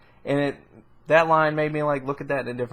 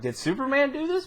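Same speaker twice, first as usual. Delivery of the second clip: much higher, lightly acted questioning; mock suspicious question; 10 semitones higher, 4 dB quieter than usual. Public wondering who caused the bombing, mock suspicion. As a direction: imitate a doubting public, pitched up.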